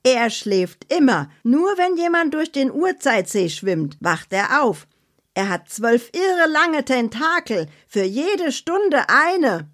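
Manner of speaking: high, dramatic spooky storytelling voice; Annabella's scary tale. Acted. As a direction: relish the horror in a high voice, slowing at the end. Annabella's character voice.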